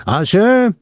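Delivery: high-pitched call for "Usher". A narrator's reading. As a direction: call across a theater. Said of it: "The soldier called across the auditorium".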